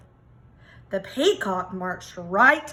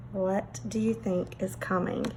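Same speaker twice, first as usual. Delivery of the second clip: softer, lower inviting question; teasing question to kids; 4 semitones lower, 5 dB quieter, slower than usual. A narrator's reading. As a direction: ask the children softly, full of mystery. Narrator asks young listeners.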